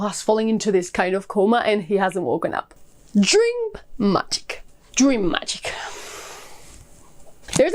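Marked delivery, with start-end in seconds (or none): 3.2-4.6 high and slowly drawn out; dramatic announcement. Acted. Reviewer teasing the book's magic system, drawn out high. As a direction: savor each word like a grand reveal.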